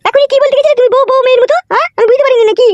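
high squeaky put-on woman's voice. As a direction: demand the money as a sharp-tongued wife in a falsetto. Plays wife Narkel Begum. (উঁচু গলায় মেয়েলি স্বরে টাকা চাওয়া বউ)

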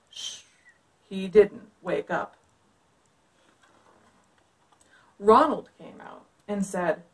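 put-on lively read-aloud for kids; story narration. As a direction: read it like a picture book. Reads picture book aloud.

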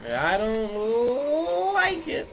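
high, very slow whine; high stretched whine. Pretend. Same line repeated rising.

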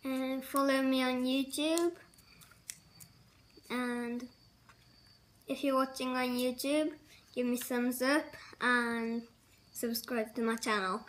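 pauses to chew between words; talking while chewing candy. Real, not acted. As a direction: mouth partly full. Seen 1: Lips pressed with chewing motions between phrases; candy unwrapped earlier.

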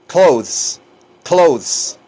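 CLOTHES in one syllable, firmly separated; teacher repeating "clothes" for learners; model pronunciation. Shown as a demonstration. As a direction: drill "clothes" as a single compact word. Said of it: "Fíjense cómo se pronuncia".

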